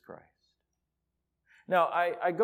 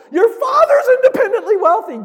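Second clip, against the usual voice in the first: laughing shout; 17 dB louder than usual. Real, not acted. Preacher exults over the story.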